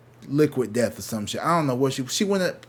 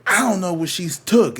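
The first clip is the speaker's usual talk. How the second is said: higher, louder mock outburst; angry clerk; 7 semitones higher, 6 dB louder than usual. Clerk refusing the boyfriend's offer to pay, imitated angry protest. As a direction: imitate an indignant clerk cutting someone off. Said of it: "he looked so angry".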